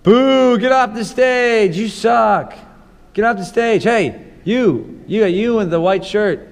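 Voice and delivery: loud, high heckler shouting from the crowd; loud drunk heckling. Pretend. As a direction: yell like a rowdy heckler. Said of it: "let's just imagine".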